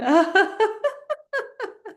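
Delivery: high burst, fading away; laughter switched on. Shown as a demonstration. Turning the silent laugh back on, high laughter. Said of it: "Turn it back on".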